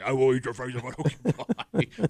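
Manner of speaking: laughing through a mock wife's threat; hammed-up menacing wife, giggling. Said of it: "wife threatening over pie".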